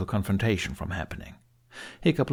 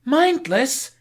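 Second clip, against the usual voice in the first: much higher and louder shriek; screech; 6 dB louder than usual. A narrator's reading. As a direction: screech the word back at him. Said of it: "she screeched".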